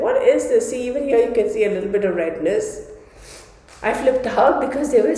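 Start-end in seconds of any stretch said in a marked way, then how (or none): 0.1-0.7 alarmed. Retold or imitated. Shocked at rash in mirror.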